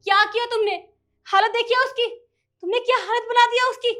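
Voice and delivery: wife confronting husband about the maid, angry; angry accusation. Acted. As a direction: confront him hard.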